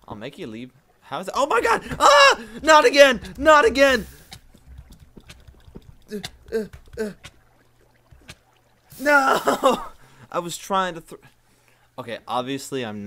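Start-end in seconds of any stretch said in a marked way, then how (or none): 1.4-4.1 high, loud dismayed shriek; screaming. Real, not acted. Dies in lava. 6.1-9.8 loud high cry of no; shouting. Real, not acted. Another failure.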